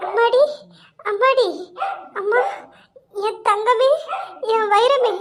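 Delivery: wailing over her daughter; weeping. Acted. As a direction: sob out short broken phrases with long gaps. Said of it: "அழாதம்மா". (மகளை நினைத்து விம்மி அழும் குரல்)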